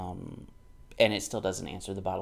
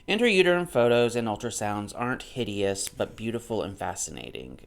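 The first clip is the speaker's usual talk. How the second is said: louder, measured reading from the page; book read-aloud; 6 dB louder than usual. Acted. Vlogger reading the author's book aloud to viewers, in a louder reading voice. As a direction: read the author's line out like a text.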